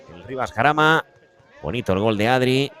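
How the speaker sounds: normal talking voice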